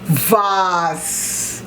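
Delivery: "Vase" said as "vahz", long open a; Jamaican pronunciation. Shown as a demonstration. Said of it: "how about vase".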